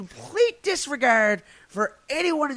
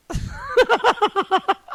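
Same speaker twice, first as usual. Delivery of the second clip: high giggle; 13 semitones higher than usual. Real, not acted. Laughing at own video.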